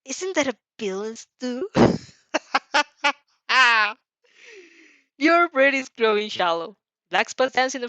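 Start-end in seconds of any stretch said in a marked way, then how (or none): 0.1-1.7 villain taunt. Acted. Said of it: "he lets out a raspy laugh". 2.3-3.1 loud raspy villain laugh, higher. Acted. Villain laughing at the hero, loud raspy laugh. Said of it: "he lets out a raspy laugh".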